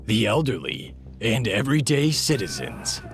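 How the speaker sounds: loud, dramatic "in a world" trailer read; horror trailer voice. Acted. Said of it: "I wrote a joke intro to this video".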